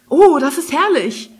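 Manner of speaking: loud, high delighted cry. A narrator's reading. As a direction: burst out with joy. Dragon delighted by the ice.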